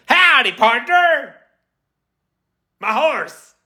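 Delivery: slow, high, loud mock American cowboy; American accent. Streamer proving the accent to viewers, hammed-up American holler. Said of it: "It's a good American".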